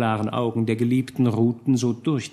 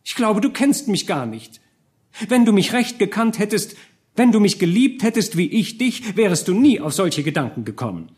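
much higher and louder, rising indignation; indignant reproach; 9 semitones higher, 4 dB louder than usual. A narrator's reading. Marie accuses Georg, indignant. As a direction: throw the accusation at him. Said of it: "sagte Marie unmutig".

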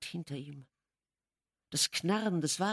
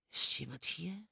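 hushed, much softer question; whispering; 8 dB quieter than usual. A narrator's reading. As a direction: whisper into the dark room. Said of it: "sagte er flüsternd".

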